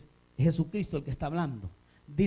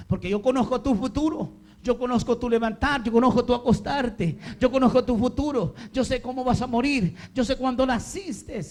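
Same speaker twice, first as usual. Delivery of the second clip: raised, driving voice of God's declaration; forceful preaching; 6 semitones higher, 5 dB louder than usual. Acted. Preacher voices God answering a believer, forceful and raised. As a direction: preach each clause high and emphatic, as a building list.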